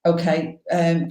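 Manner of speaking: ordinary talk, nothing marked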